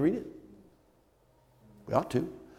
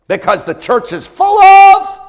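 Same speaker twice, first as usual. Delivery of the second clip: high, loud mocking voice of a church critic; mock scornful outsider; 16 dB louder, slower than usual. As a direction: sneer it like someone who scorns church. Mocking what people say.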